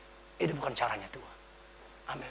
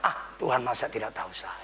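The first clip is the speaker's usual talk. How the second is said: sheepish whisper; 7 semitones lower, 6 dB quieter than usual. Acted. Peter's quoted excuse inside scolding.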